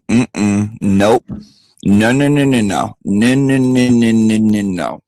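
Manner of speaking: loud repeated no, no, no; emphatic refusal. Retold or imitated. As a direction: shut it down hard. Retold refusal, building no's.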